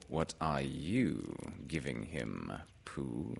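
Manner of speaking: low, soft, slow bird voice; Owl. Acted. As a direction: hand the question back ponderously. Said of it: "said Owl".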